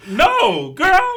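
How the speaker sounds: put-on high, loud "girl" rising; retold scream. Reacting to the article, mock scream. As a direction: shriek no girl. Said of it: "I'm screaming".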